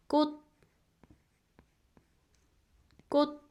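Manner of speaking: teacher models "꽃" for learners; Korean syllable kkot. Shown as a demonstration. Teacher sounding out "꽃" for students. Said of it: "the first syllable makes".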